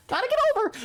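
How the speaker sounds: very high squeaky mock driver; mocking oblivious driver. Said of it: "looking out their left window like".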